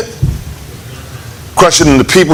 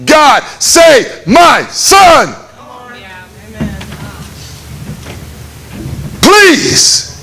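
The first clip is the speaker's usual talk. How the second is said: crying out to God; 5 semitones higher, much slower than usual. Retold or imitated. Father crying out to God to save his sick son, desperate shout. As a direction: cry out to God for your son. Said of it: "I started screaming out to God".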